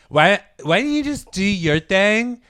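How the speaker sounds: slow, high, loud snotty whine; snotty heckler. Retold or imitated. Heckler tells comic to mind his thing, slow and whiny. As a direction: drag it out like a sulky drunk. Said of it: "She goes".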